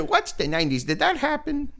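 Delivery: joking feigned ignorance, jumping high at the end; mock cluelessness. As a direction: play clueless through a laugh. Mocking a too-young person's ignorance.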